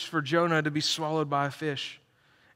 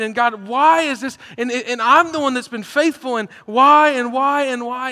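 a builder of pleading questions, getting louder and higher; frustrated why; 6 semitones higher, 7 dB louder than usual. Retold or imitated. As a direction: voice the believer's mounting complaint to God. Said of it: "asking all these questions like".

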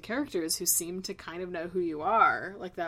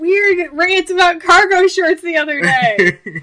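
high, loud laughing tease; 11 semitones higher, 19 dB louder than usual. Real, not acted. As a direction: laugh through the teasing. Teasing about a rant.

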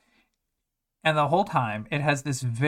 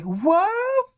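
very high loud squeak; the class reels in disbelief; shrieked shocked question; stunned what; 5 dB louder than usual. Retold or imitated. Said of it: "And they're like".

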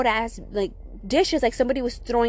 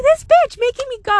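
very high, louder retold outburst; panicked protest; 14 semitones higher, 6 dB louder than usual. Child forced back downstairs by sister, acted panicked protest. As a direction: act out panicky outrage, pitched way up. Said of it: "I was panicking".

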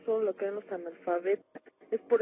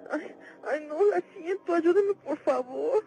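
terrified plea; 7 semitones higher, 6 dB louder than usual. Acted. Caller feels the shadows come.

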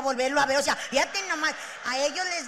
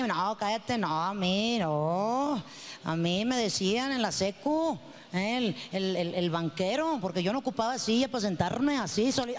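bragging guy; 6 semitones lower than usual. Retold or imitated. Mocking men showing off when alone, in a lower macho voice.

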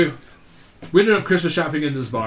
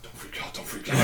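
urgent whisper; 10 dB quieter than usual. Retold or imitated. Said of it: "the guy behind him who's pushing".

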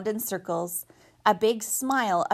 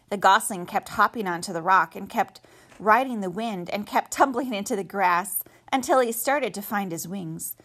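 plain reading voice

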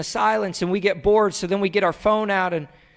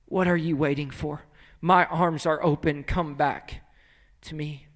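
low, soft, gentle pleading; tender invitation; 6 semitones lower, 7 dB quieter than usual. Acted. God calling his son back, tender soft invitation. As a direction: invite them home softly.

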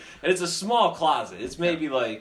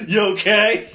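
loud check-in; 6 semitones higher, 8 dB louder than usual. Retold or imitated. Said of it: "I'm like".

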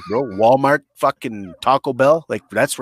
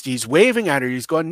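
ordinary delivery

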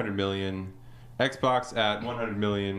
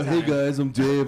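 laid-back surfer bro; lazy skater drawl, a bit loud; skater dude voice; 5 semitones higher, 5 dB louder than usual. Retold or imitated. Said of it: "You gonna do some skateboard tricks or something".

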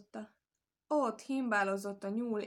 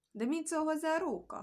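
hesitating; 8 semitones higher than usual. A narrator's reading. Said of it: "habozott a nyúl".

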